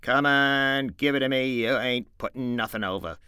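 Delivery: insist, no nonsense; stern George. Acted. George to Lenny, firmly demanding the mouse back.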